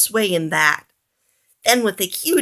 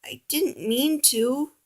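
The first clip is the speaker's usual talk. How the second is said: softer, slow, small voice; ashamed apology; 6 semitones higher, 5 dB quieter, slower than usual. A narrator's reading. Oliver apologizes to Piggy, soft and ashamed. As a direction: hang your head and apologize. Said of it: "Oliver hung his head".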